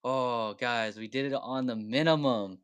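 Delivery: normal talking voice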